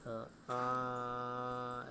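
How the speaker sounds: अ short, आ held longer; vowel length demo. Shown as a demonstration. Teacher demonstrating vowel length to the class, drawn out. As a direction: sound out अ then stretch आ. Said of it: "जैसे उदाहरण में".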